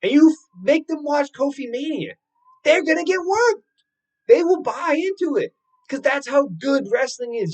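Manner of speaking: rising excited outburst. Real, not acted. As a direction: shout how good wrestling is. Hyped about wrestling's power.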